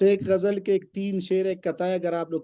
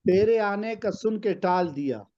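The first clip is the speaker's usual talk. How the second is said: poem recitation. Acted. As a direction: recite the couplet with weight. Recites a ghazal couplet.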